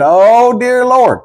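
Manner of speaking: high, loud, drawn-out cry of dismay; mock alarm. Young soldier accused of having a demon, mock alarm. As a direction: stretch it out high in comic shock.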